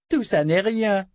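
high, mocking little-girl voice; cruel child. A narrator's reading. Child princess taunting her captive Richard, high sneering voice. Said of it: "dit-elle".

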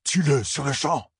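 «Tue-le» crié, plus haut et plus fort, lent et appuyé; cri insistant. Acted. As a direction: crier l'ordre de tuer, lentement. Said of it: "cria Loustérine".

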